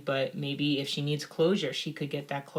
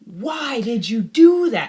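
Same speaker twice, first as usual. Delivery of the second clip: slow, loud scolding parent; 7 semitones higher, 9 dB louder, slower than usual. Retold or imitated. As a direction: snap it at a messy kid. Scolding a child.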